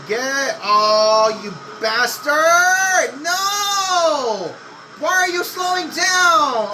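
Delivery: high furious shout; angry outburst. Real, not acted. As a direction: yell at the slow car in real anger. AI car blocks him on track.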